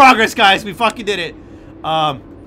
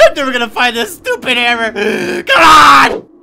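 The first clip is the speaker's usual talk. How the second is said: half laughing, half crying shout; exasperated yell; 4 semitones higher, 8 dB louder than usual. Real, not acted. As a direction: lose it at the stupid game. Can't find hammer, yelling.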